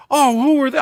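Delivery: high, loud imitation of a baffled lady; hammed-up incredulous homeowner; mock bewilderment. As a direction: protest in exaggerated disbelief. Lady asks who kids were.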